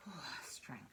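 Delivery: whisper. Real, not acted. Reader whispering the card name as it is revealed. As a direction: breathe it out as the card turns.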